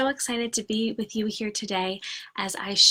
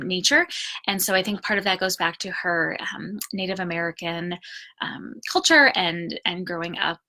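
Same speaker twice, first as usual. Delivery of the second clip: ordinary talk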